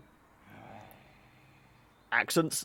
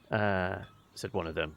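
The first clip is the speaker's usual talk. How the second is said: ordinary delivery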